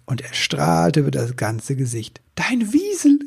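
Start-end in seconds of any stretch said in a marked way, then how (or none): 2.4-3.2 high, delighted exclamation; full of enthusiasm. A narrator's reading. Elf spots his friend's weasel, bursting with enthusiasm. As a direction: call it out with leaping pitch. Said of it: "rief er voller Begeisterung".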